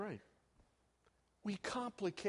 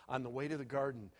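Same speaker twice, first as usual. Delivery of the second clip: ordinary talk, nothing marked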